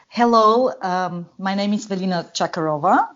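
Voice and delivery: ordinary delivery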